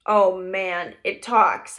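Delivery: ordinary delivery, nothing marked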